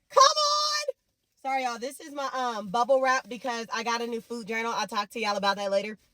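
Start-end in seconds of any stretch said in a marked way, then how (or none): 0.1-0.8 high praise whoop; high excited cry. Real, not acted. Recalling the pastor's words, she whoops high. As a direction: call it out high in joy.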